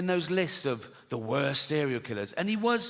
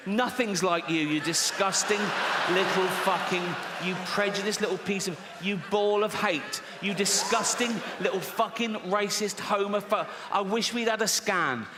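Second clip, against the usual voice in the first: mock furious rant; 3 semitones higher than usual. Rant continues at Timmy.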